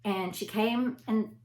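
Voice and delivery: ordinary delivery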